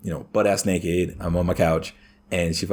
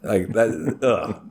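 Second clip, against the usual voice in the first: ordinary delivery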